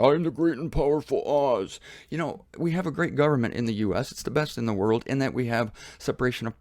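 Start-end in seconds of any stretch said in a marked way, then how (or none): 0.0-1.8 mock Wizard of Oz. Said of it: "Wizard of Oz head there".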